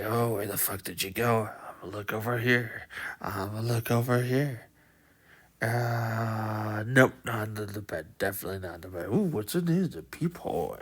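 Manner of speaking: low, quiet menacing mutter; low muttering monster. Retold or imitated. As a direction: search the room, muttering to yourself. Giant searching room, muttering.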